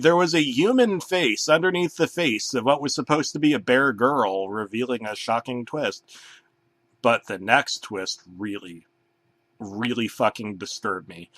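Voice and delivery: normal talking voice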